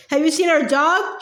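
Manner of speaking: ordinary delivery, nothing marked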